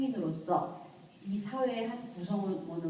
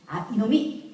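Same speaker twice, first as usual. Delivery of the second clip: scolding outburst; 3 semitones higher, 5 dB louder, slower than usual. Retold or imitated. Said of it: "뭐라 그래요?".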